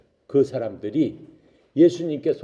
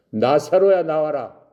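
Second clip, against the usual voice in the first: loud, high summons; raised commanding shout, acted; calling out; 6 semitones higher, 7 dB louder, slower than usual. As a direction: call someone forth from afar. Jesus calls Lazarus out again.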